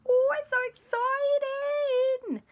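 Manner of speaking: very high, slow gushing; mock excited Lisa. Auntie Lisa arriving at the lab, slow and very high. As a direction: stretch it out over-thrilled.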